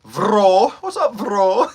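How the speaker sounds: loud, high trilled R on "vro"; rolled R in "vro". Shown as a demonstration. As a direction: roll the R playfully in "vro". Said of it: "to roll my R's".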